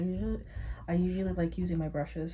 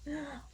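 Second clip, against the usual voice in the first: quick startled intake of breath; sharp gasp; 8 semitones higher, 9 dB quieter than usual. Real, not acted. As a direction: gasp at the clock. Realizes how late it is.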